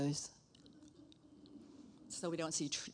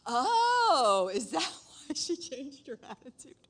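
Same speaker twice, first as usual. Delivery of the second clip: high, loud surprised oh, laughing; amused surprise breaking into a laugh; laughing realization; 7 semitones higher, 7 dB louder, slower than usual. Retold or imitated. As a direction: catch yourself mid-discovery. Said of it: "I said".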